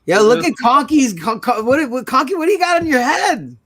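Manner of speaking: high, rising disbelief; amused astonishment. Real, not acted. Host spots something odd on Conky's head, astonished. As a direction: stammer it out high, incredulous.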